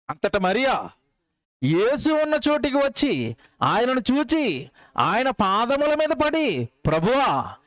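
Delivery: slow, rising, preached scripture read; slow fervent Bible reading; solemn scripture reading. Acted. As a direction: draw each word out like a pulpit reading. Reads scripture slowly.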